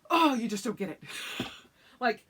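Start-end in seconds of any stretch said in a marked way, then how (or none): 0.1-1.0 exasperated retort. Retold or imitated. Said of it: "And I'm like".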